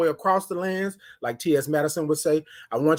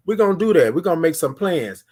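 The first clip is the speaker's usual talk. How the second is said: usual speaking voice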